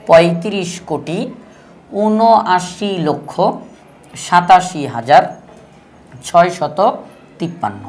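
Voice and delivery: each number group said slowly, with pauses between; slow demo reading. Shown as a demonstration. Teacher slowly reads the number aloud to the class. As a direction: read the full number out slowly for students. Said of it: "উচ্চারণ করব কিভাবে?".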